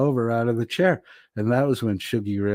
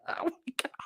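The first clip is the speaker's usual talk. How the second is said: breathless whisper after laughing; 11 dB quieter than usual. Real, not acted. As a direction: gasp it out, out of air. Exhausted from laughing.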